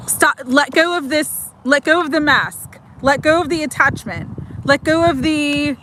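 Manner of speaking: ordinary talk, nothing marked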